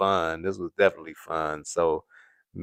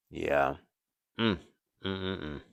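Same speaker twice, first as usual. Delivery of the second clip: usual speaking voice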